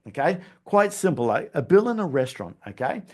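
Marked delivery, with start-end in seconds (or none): none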